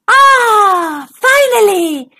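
loud relieved exclamation; relieved cry. Acted. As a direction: sigh out the relief. Monster relieved.

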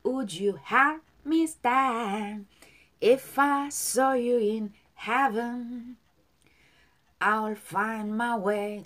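very slow, sustained, connected words; linked slow model. Shown as a demonstration. Teacher links the lines for learners to repeat, slow and stretched. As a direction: join the phrases, drawing out each word. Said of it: "자, 연결".

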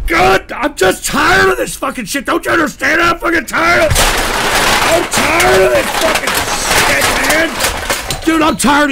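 real angry outburst at trolls; exasperated shouting. Real, not acted. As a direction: scream out your frustration.

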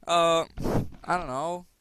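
usual speaking voice

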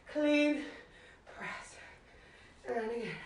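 breathless between reps; puffing through the words; out of breath. Real, not acted. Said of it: "I'm kinda out of breath".

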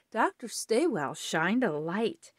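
ordinary delivery

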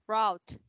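"wrote" said /rot/ with a falling tune; model pronunciation. Shown as a demonstration. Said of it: "mình đọc là wrote nha". (cô đọc mẫu "wrote" với giọng xuống)

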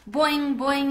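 high, loud boing; boing like a happy frog. A narrator's reading. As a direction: frog answering his friend. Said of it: "he replied".

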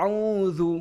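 আউযু said with a soft dh, not জু; soft dhal. Shown as a demonstration. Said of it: "জাল নরম হবে".

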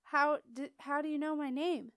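put-on a flustered boy stammering. A narrator's reading. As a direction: stumble over the start, caught off guard. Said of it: "stammered Beetle".

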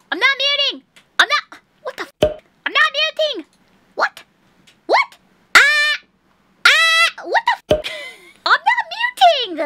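high, loud squealing complaint; frustrated protest. Real, not acted. Streamer insisting she isn't muting, loud high protest. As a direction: yelp it, high and indignant.

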